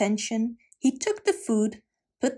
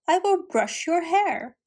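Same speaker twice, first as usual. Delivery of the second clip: falsely sweet, higher voice; sweet-talking stepmother; 7 semitones higher than usual. A narrator's reading. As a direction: pretend kindness to the girl. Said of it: "acted as if she didn't mind".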